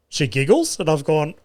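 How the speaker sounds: ordinary delivery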